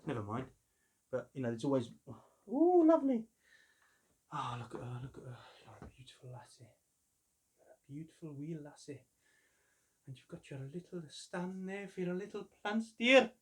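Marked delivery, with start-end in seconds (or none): 2.5-3.2 high, loud delighted gasp. Real, not acted. Collector gasps high and loud at unboxed figure. 4.3-6.7 soft whispered coo; whispering. Real, not acted. Collector whispers fondly to his new figure. 7.6-13.3 doting. Pretend. Talking to figure fondly.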